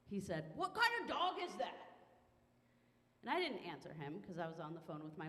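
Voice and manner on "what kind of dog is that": put-on higher, barked drunken pickup line; drunk guy yelling. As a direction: holler it like a drunk bar guy. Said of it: "he yelled out a killer line".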